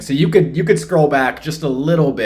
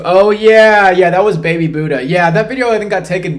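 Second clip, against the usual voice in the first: excited; 8 semitones higher, 10 dB louder than usual. Real, not acted. Recognizing video from chat.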